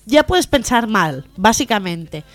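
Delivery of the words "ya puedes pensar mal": ordinary delivery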